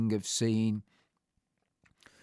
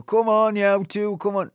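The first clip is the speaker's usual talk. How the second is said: higher and louder, calling them to get up; retold wake-up call; 9 semitones higher, 8 dB louder than usual. To friends asleep on the landing, a loud retold wake-up call. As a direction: rouse two sleepers on the landing with a call.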